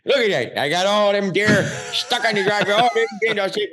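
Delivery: high, proud Chicago uncle bragging; Uncle Mike. Retold or imitated. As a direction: crow over a feat in a thick Chicago accent. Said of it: "He was like".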